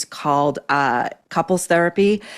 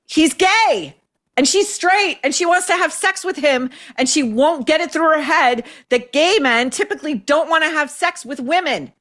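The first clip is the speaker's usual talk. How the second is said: mocking wife voice; 10 semitones higher than usual. Host mocking wife's complaint.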